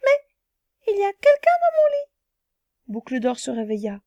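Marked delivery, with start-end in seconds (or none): 0.0-2.1 very high, loud squeaky cry; exclaiming little bear. Acted. Little bear discovering Goldilocks in his bed, high loud squeal. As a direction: squeal it like a startled cub. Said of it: "Le petit ours s'écria".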